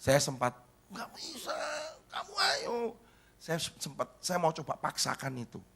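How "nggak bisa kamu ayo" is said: high, squeezed mock plea; mock insistent parent. Father coaxing his reluctant child to play, high mock insistence. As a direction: push in a much higher, softer voice.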